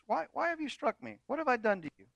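high, soft plaintive donkey; a hurt animal pleading. Acted. Preacher voices the donkey.

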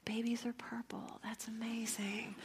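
soft whisper of naive wonder; awed whisper. Retold or imitated. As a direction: marvel quietly at the baby. Mother marvels at newborn.

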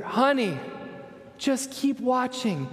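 high, slow calling reassurance; calling back. Retold or imitated. Dad calling reassurance to his daughter in the other room. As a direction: call it back gently across the house. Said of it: "And I say to her".

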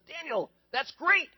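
the king thrilled, drawing it out; overjoyed king praising Daniel; delighted king. Retold or imitated.

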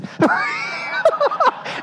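high shrieking laugh, real; burst of laughter. Real, not acted. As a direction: break into a high laughing shout. Telling funny story, laughing hard.

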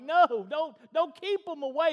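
ticked-off shout, high and loud; Jesus. Acted. Ticked teacher stopping his disciples, angry shout. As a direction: burst out in indignant rebuke. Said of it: "he was ticked".

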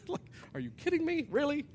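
high-pitched, incredulous questioning; high, disbelieving protest. Acted. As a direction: react in sheer disbelief. Acts out disbelief at the words.

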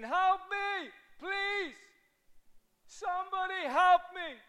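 high, strained voice of a drowning person; desperate cry for help. Retold or imitated. Voicing drowning people crying out.

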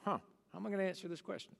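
soft, fast inner monologue; inner thought. Retold or imitated. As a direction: rush it under your breath, stumped. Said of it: "inside we think".

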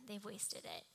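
ordinary talk

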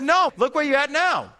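loud, high comeback; heated retort. Retold or imitated. Acted retort in heated quarrel.

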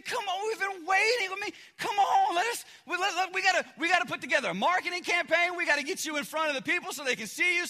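bursting with disbelief, then pitching big plans; indignant disciples. Acted. Disciples protest Jesus's secrecy.

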